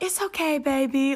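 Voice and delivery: high, doting motherly coo. Acted. Mom bumped into at the grocery store, sweet reassurance. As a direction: soothe someone like a sweet mom.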